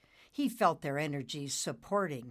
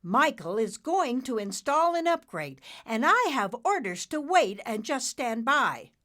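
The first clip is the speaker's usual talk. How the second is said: with conviction; 8 semitones higher, 7 dB louder than usual. Acted. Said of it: "then added with conviction".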